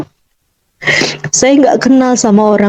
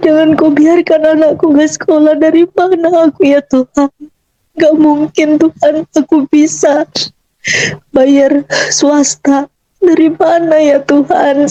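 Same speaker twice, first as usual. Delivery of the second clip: higher-pitched, anguished prayer; tearful pleading; 3 semitones higher than usual. Retold or imitated. Mother begging God for her child's schooling, tearful pleading. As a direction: plead with God on the edge of tears. Retold desperate plea to God.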